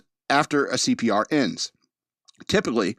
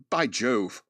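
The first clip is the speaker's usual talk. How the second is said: mock British accent; 2 semitones lower than usual. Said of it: "all of a sudden I have a British accent".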